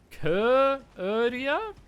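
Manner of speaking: køøria drawn out very slowly with a front rounded vowel, rising like a question; checking the vowel. Shown as a demonstration. Asking a friend to confirm the vowel in køøria. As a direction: stretch out køøria slowly, testing the sound. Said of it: "You're saying it like u".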